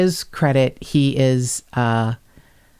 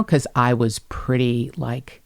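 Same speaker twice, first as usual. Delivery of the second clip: ordinary talk, nothing marked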